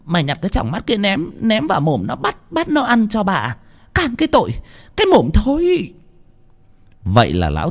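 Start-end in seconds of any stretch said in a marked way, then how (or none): 0.0-5.9 high-pitched, furious mistress tirade; angry scolding mistress. Acted. Mistress ordering the old man's punishment, angry scolding.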